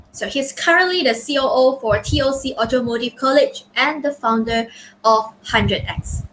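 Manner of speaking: ordinary talk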